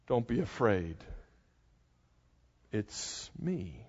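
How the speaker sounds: preacher closing with Jesus' comfort, hushed; soft reassurance. Acted. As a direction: let it fall to a near hush, tender. Preacher echoing Jesus softly.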